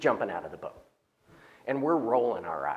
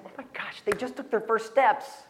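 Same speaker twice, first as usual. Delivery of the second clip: thrilled parent; 7 semitones higher than usual. Retold or imitated. Parents thrilled at first steps.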